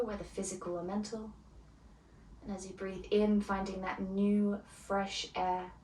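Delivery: soft, unhurried meditation voice; guided meditation. Acted. Yoga teacher guiding students into calm, soft meditation voice. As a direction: ease the class gently into stillness. Leads opening meditation before lesson.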